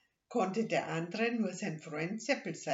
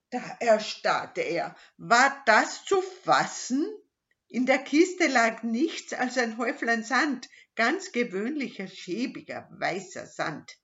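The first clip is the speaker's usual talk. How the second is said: stunned narration; 6 semitones higher, 9 dB louder than usual. A narrator's reading. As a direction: build the shock word by word. Narrator builds shock at sand.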